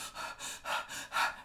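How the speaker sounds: short nervous puffs of breath; panicky breaths. Retold or imitated. Speaker called up to share, panicky huffing breaths. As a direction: huff as if panicking, unable to speak.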